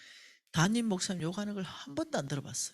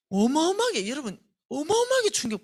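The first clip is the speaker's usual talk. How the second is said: shocked outburst; 9 semitones higher, 7 dB louder than usual. Real, not acted. Preacher describes his shock.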